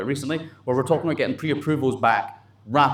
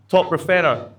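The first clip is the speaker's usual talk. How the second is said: loud, high announcer call; punchy, raised-voice category announcement; award announcement; 5 semitones higher, 5 dB louder than usual. Real, not acted. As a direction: call out the award title to the room.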